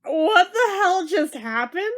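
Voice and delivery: slow, stunned what the hell; drawn out in disbelief, a catch in the voice; shocked disbelief. Real, not acted. Viewer stunned by grandpa's sudden death, slow shocked disbelief.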